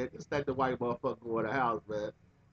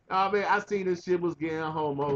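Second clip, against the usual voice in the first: ordinary talk, nothing marked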